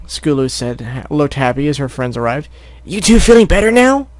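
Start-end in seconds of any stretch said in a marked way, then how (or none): 2.9-4.1 high lively filly voice. Acted. Scootaloo greeting her friends, high voice. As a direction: ask brightly as a filly.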